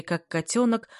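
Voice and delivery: ordinary delivery, nothing marked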